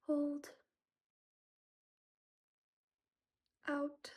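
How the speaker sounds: soft, slow meditation counting; guided breathing. Acted. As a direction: guide the breaths calmly and softly.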